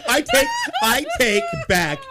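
squealing through laughter; laughing. Real, not acted. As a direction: laugh it out at full height. Laughing outburst at punchline.

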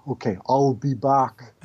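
put-on slow, deliberate Austrian Arnold accent; Arnold impression. Joking with friends, slow Austrian-accented Arnold. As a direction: drag out I'll be back like Arnold. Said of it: "Arnold Schwarzenegger is really fun to do".

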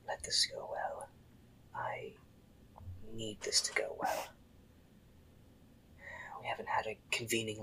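soft unvoiced prayer whisper. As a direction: pray under your breath. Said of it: "you hear her say".

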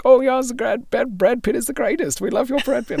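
put-on high-pitched, gushing German accent. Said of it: "all of them are German".

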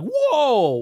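amazed whoa. Retold or imitated. Imitating amazed fans.

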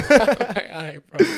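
chuckling farewell; saying bye to the kid, laughing; laughing goodbye. Retold or imitated. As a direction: laugh through the send-off. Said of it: "I'm like".

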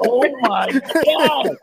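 slow oh my God, laughing; amused groan of disbelief. Real, not acted. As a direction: drag it out through laughter.

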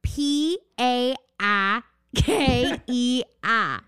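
put-on slow Boston-accented spelling, r said as "ah"; imitated Boston accent. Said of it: "story about Boston accent".

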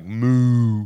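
a cow's moo, half-joking; mock moo. Shown as a demonstration. Moo like a cow to show listeners the word. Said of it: "we're saying C O W".

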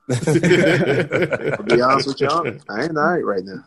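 slow, laughing admission; slow laughing admission of nerves; laughing confession. Retold or imitated. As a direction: laugh through the nervous truth.

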